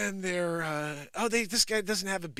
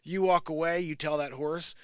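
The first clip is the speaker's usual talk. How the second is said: normal talking voice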